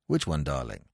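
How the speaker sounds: low, soft doting father; low fond father humouring his son; father's voice. Acted. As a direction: coo it down low to the child. Father soothing his bratty son.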